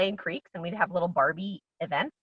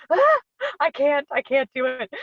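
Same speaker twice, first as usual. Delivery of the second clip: higher, lightly flustered mock refusal; retold panicky protest; 9 semitones higher, 6 dB louder than usual. Actor balking at friends urging her to audition, mock panicky protest. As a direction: act out balking with a gasp. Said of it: "And I was like".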